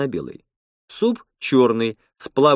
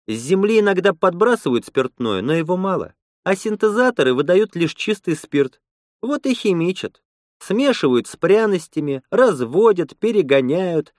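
higher, lively woman's voice; Lena, explaining; 7 semitones higher than usual. Acted. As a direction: explain it in a lightly raised female voice.

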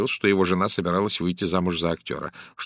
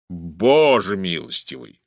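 slow, drawn-out, louder exclamation; astonished exclamation; 5 dB louder, slower than usual. A narrator's reading. Japp hears Poirot's theory about the actor, exclaims astonished. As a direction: stretch it out in amazement. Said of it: "воскликнул Джеб".

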